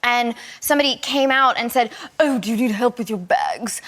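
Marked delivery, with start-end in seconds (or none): 2.2-3.8 put-on posh British accent. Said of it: "sounds like an exaggeration of their voice".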